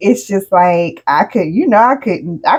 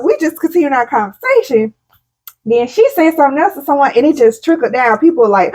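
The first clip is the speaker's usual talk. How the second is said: ordinary delivery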